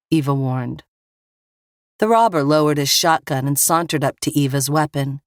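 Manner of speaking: ordinary delivery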